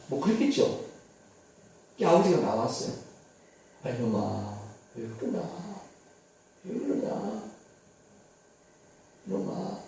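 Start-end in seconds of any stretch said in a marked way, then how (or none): 3.8-9.8 softer, tender father coaxing his son; gentle fatherly plea. Acted. Father comes out to his sulking elder son, soft and tender. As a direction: soothe him like a hurt father.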